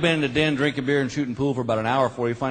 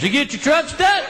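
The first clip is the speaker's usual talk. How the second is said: dumb cop question; 11 semitones higher than usual. Retold or imitated. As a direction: shout the obvious question.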